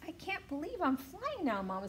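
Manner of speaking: high, drowsy little gosling; sleepy chick talk. A narrator's reading. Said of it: "in his sleepy voice".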